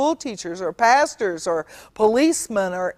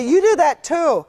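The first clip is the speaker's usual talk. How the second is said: high, louder mock whine of a sassy kid; higher, louder impression of a mouthy child; bratty child; 7 semitones higher, 4 dB louder than usual. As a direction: mimic a kid sassing back. Child talking back to parent.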